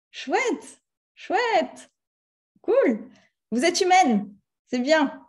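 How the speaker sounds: high, bright delight. Real, not acted. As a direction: cheer them on. Réagit aux réponses du public. (ravie, encourage le public d'une voix haute)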